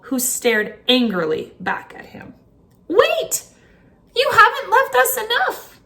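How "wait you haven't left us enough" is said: high, loud call after him. Acted. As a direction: shout up at the departing bird. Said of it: "one woman called to him".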